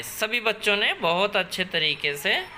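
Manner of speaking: ordinary delivery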